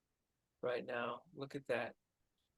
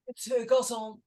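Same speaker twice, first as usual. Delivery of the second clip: high, fussy upper-class gent; posh gentleman voice; 8 semitones higher, 8 dB louder than usual. Acted. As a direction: play the anxious posh date. Posh French word for waiter.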